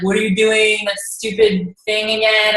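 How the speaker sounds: lightly voiced scoffing husband, slowing on the jab. Husband scoffing at her phone posts, in a lightly voiced dismissive tone. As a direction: imitate a dismissive husband, a bit slow.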